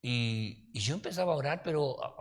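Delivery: ordinary talk, nothing marked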